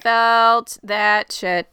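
slow, drawn-out "Felt that shit"; heartfelt, stretched-out solidarity; emphatic agreement. Acted. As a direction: lean hard on every word. Emphatic repeat of the same feeling.

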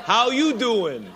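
playful New York "How you doing?"; Brooklyn accent. Pretend. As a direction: lay on a Bronx swagger. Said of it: "That's for the Brooklyn and Bronx girls".

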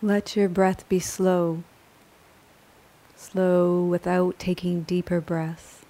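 calm, measured yoga nidra guidance; unhurried, even-toned relaxation voice; guided meditation. Acted. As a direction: guide a resting listener gently, with long pauses between phrases.

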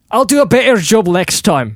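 high, loud put-on accent; too-loud accent attempt. Host promising to improve, peaking loud and high. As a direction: belt it out, overloading the mic. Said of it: "you're peeking".

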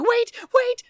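high-pitched flustered mock panic; mock nervous. Imitating a flustered secretary stalling her caller, nervously high. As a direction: squeak it out, imitating someone flustered. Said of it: "She's all, like, nervous".

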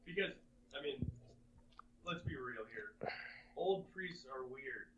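distant, faint voice off the mic; far from mic. Real, not acted. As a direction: sounds far from the microphone. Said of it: "You sound very distant".

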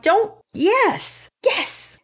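very high squeal of approval; re-enacted thrilled viewer reaction; excited. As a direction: cheer it far up high. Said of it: "I was all excited".

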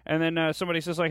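normal talking voice

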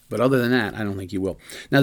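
ordinary talk, nothing marked

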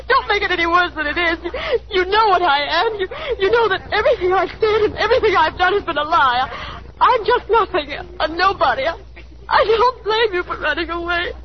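high, sobbing plea, words breaking with tears; crying. Acted. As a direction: beg him to go while crying openly. Alice breaks down, sends him away.

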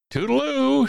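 very high, loud trilled goodbye; singsong farewell. A narrator's reading. Lazy bird fluttering off on vacation, singsong farewell. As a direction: sing it out airily as you leave. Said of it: "sang Maisie and fluttered away".

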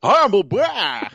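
put-on slow, high old prospector voice. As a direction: drawl it like a gold-rush prospector. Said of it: "you sound like a prospector".

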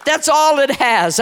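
usual speaking voice

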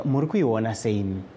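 usual speaking voice

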